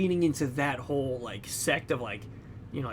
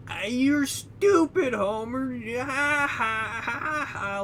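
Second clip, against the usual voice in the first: much higher, slow mocking brat laugh; higher, slow mock taunt of Homer by the kid; 9 semitones higher, much slower than usual. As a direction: drag out the insult then cackle at him. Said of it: "laughing at him".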